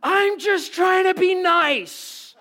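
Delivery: drawn-out, high-pitched furious scream, acted out; full-force hammed-up shout; yelling at top of lungs. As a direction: scream it slowly across a fence. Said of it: "me yelling at him at the top of my lungs".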